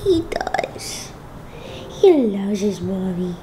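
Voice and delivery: put-on tiny voice speaking for the child; whispered baby voice. Mom whispering for her baby son, tiny voice.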